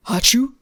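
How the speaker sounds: loud mock achoo sneeze; dad sneeze. Shown as a demonstration. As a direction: belt out a fake sneeze. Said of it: "what is a dad sneeze".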